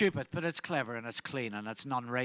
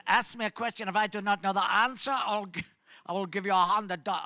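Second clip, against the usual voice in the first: put-on loud, high German-accented boast; 7 semitones higher, 6 dB louder than usual. To the crowd, the German seatmate offering a bet, German accent. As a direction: lay on a German accent again. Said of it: "it had a German accent".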